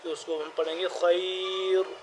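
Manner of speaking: ordinary talk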